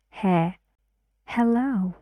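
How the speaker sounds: usual speaking voice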